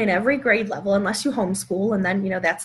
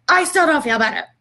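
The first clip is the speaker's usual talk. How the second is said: put-on higher, louder whiny kid voice; son's complaint; 6 semitones higher, 5 dB louder than usual. Son telling mom breathing didn't help, higher and louder whine. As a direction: act out a grumpy boy who still feels bad. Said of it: "he's like".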